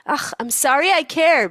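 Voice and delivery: slow, high, loud huff; mock insulted. Mother apologizing for caring, mock insulted. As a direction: draw out a wounded non-apology. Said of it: "she's so insulted".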